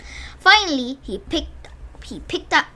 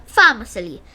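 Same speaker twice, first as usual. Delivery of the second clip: ordinary delivery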